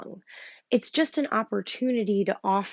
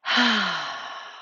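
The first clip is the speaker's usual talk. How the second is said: demo breath out. Shown as a demonstration. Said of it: "a few big breaths out".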